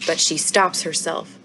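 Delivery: ordinary delivery, nothing marked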